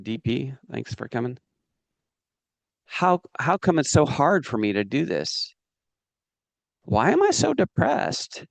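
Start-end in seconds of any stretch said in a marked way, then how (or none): 2.8-8.4 mock despair. Coach mocking wrong questions, hammed-up despair. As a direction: whine it like a hopeless case. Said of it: "they ask the wrong questions".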